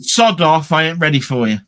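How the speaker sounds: loud mock brush-off; sister shouting sod off. Sister telling him to sod off, loud and blunt. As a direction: bark it like a fed-up sister. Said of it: "My sister said".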